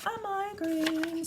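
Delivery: high, slow, drawn-out answer to her own question. Real, not acted. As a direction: land the punchline high. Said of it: "giving myself a what?".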